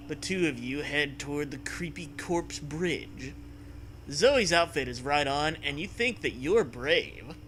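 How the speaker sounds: normal talking voice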